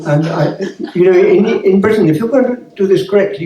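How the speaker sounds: ordinary talk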